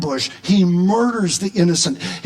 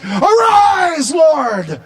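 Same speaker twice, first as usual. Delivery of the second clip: high, loud rallying shout; drawn-out, ringing battle shout; battle cry; 7 semitones higher, 5 dB louder, much slower than usual. Acted. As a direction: call God up to battle. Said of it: "This is a battle cry".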